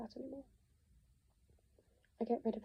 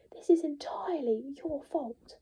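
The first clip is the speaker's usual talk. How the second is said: higher, louder, deliberately slow; slow furious blame; 4 semitones higher, 6 dB louder, slower than usual. Acted. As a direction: lay the blame word by word. Blaming Nick.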